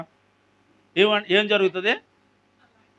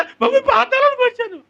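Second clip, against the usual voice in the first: sudden jump to a high voice; high-pitched acted cry; 14 semitones higher than usual. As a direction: cry it out high, as if acting the newcomer. Acts out arriving in the underworld.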